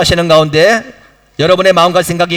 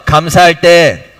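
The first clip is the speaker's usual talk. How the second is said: slow and deliberate, for the congregation to repeat; slow call for repetition; 4 semitones lower, slower than usual. Shown as a demonstration. Pastor leading the congregation in a repeated line, slow and deliberate. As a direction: say it slowly so they can echo it. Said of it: "자, 한번 따라하세요".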